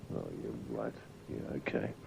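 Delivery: low, barely voiced mumble; grudging muttered agreement to yourself; muttered aside. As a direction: mumble it under your breath.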